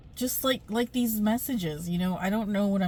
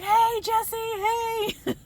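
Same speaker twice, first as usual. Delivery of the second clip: much higher, eager fangirl hello; excited greeting; 3 dB louder, much slower than usual. Retold or imitated. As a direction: call out to him like a starstruck fan. Greeting him excitedly from car.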